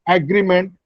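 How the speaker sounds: "agreement" said AG-ree-ment; full "a" as in "ag" opening "agreement"; common Indian pronunciation. Shown as a demonstration. Teacher repeats the common Indian "agreement", stressed on AG. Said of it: "which is the common Indian pronunciation".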